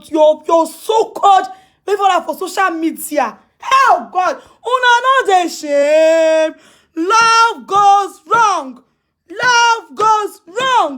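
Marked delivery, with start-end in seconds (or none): 3.6-6.5 slow, drawn-out scolding; long, shaming wail; scornful drawl. Real, not acted. Scolding people as shameless. 6.9-10.9 very slow, stretched-out words; slow, jeering singsong; mocking refrain. Real, not acted. Mocking refrain about failed love.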